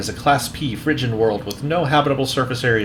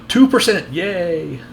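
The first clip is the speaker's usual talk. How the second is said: mock cheer; 7 semitones higher, slower than usual. Real, not acted. Cheering at loading progress.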